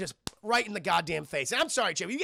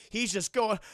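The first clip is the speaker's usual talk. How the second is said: ordinary talk, nothing marked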